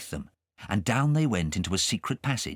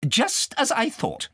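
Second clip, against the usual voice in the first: scolding, high and sharp; cross governess; 14 semitones higher, slower than usual. Acted. Governess scolding Kay over pork pie, cross. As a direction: tell him off like a strict governess.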